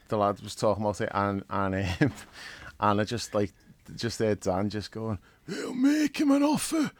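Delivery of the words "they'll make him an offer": high, loud, drawn-out mobster impression; Dan Corleone impression. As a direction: belt out a slow, strained godfather line. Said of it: "Dan Corleone impression".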